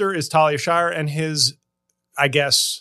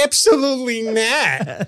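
higher, indignant put-on refusal; mock Italian American dater; 5 semitones higher than usual. Comic as dating-app match refusing him, mock indignation. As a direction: dismiss it in high mock outrage. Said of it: "They'd be like".

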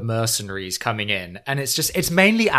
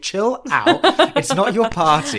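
higher, laughing rebuke; exasperated scolding; 8 semitones higher, 4 dB louder than usual. Retold or imitated. Friend scolding Albie while laughing, higher. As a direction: laugh it off at him. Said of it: "And it's like".

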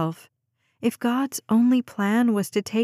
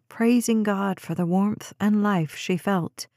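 usual speaking voice

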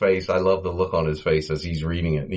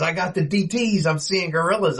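higher panicked Curly voice; imitating Curly; 8 semitones higher than usual. Curly insisting he's seeing gorillas, higher flustered squeak. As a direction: pitch it up, flustered and scared.

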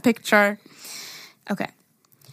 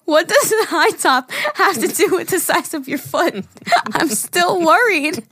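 laughing; 6 semitones higher than usual. Retold or imitated. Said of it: "I go".

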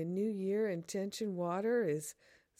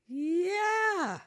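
high, loud joyful yell; excited cheer; 11 semitones higher, 6 dB louder than usual. Real, not acted. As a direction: cheer it out with delight. Cheering her class launch.

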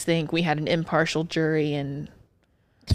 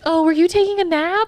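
high, loud, rising sugary question; chipper nurse voice; 11 semitones higher, 6 dB louder than usual. Retold or imitated. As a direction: coo it like a perky nurse. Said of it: "My favorite is".

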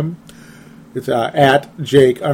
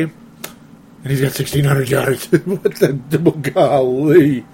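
laughing disbelief; slower than usual. Real, not acted. Amused disbelief at yards.

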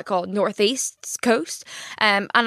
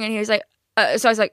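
ordinary talk

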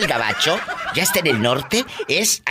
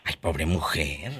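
low, soft, slow sympathy; hushed, drawn-out commiseration; hammed-up pity; 12 semitones lower, 8 dB quieter than usual. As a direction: sigh it out slowly with pity. Host pities the tired guest.

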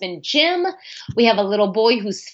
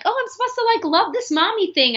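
much higher, mock sing-song voice of the perfect mother; mock dutiful mom; 12 semitones higher, faster than usual. New mom mocking what she was supposed to feel, in a high sing-song voice. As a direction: tease the ideal of loving every mommy moment. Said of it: "'cause I'm like".

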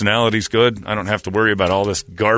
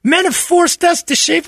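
much higher, slow falsetto woman; mocking women's voice; slower than usual. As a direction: squeak it like a shrill activist. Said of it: "other ladies are like".

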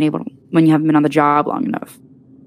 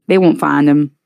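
almost annoyed; 5 dB louder than usual. A narrator's reading. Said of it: "he said, almost annoyed".

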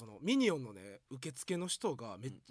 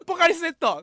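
yelled while cracking up; laughing shout; 18 dB louder than usual. Retold or imitated. Teller re-yelling the junior's line while laughing hard. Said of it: "大爆笑してもって".

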